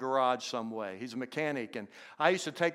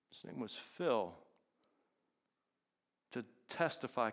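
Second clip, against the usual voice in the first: hushed, softer sermon delivery; solemn preaching; 4 semitones lower, 8 dB quieter than usual. Real, not acted. Preacher challenging the congregation to share faith, softly solemn. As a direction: drop to a soft, searching appeal with long pauses.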